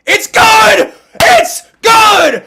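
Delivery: high, very loud celebration shouts; full-throated, high-pitched sportscaster eruption; ecstatic screaming. Real, not acted. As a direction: scream the winning kick call in real disbelief and joy. Calls the winning field goal live.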